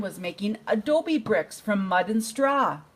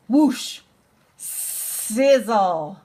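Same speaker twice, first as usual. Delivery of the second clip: sound effect; 3 dB louder, much slower than usual. Acted.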